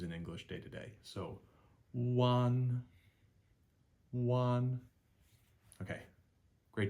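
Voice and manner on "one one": "one" held high and level, no dip; sing-songy first tone. Shown as a demonstration. Teacher showing the high first tone register. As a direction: set your voice high and keep "one" flat. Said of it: "first tone is the sing-songy tone".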